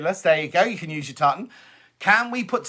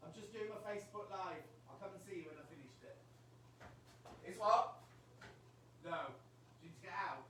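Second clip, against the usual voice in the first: off mic; 26 dB quieter than usual. Real, not acted. Aside to neighbour off mic.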